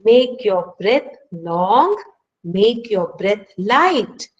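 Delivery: slow, drawn-out guidance on breathing; unhurried breathing coach; slow calming instruction. Shown as a demonstration. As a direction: stretch each phrase slowly as if guiding the breath. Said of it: "So they say".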